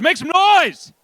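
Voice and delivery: high-pitched crowd-rousing call; rallying shout. Real, not acted. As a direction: call out to fire up the room. MC rallies the audience.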